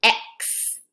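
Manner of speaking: x as a sharp unvoiced ks; x at word end. Shown as a demonstration. Teacher pointing out the final x sound, a crisp ks. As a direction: push out a crisp ks. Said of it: "Do you hear it?".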